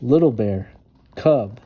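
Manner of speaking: usual speaking voice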